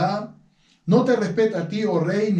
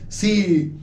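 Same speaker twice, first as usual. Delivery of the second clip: ordinary talk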